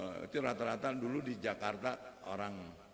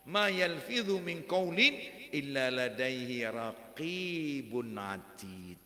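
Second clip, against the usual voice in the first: chanted Quran verses, raised and sustained; Quranic recitation; 7 semitones higher, 7 dB louder than usual. Acted. Preacher reciting Surah Qaf to the congregation, chanted. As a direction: recite the verses in melodic tajwid.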